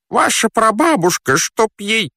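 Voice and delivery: very high, agitated character voice; exasperated fat man. Acted. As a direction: curse in a squeal, breaking off. Stranded fat man curses his helper. (раздражённый толстяк, визгливо и высоко)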